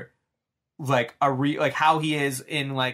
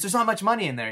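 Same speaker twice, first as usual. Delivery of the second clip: nervous Charlie; 7 semitones higher, faster than usual. Retold or imitated. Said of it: "He goes".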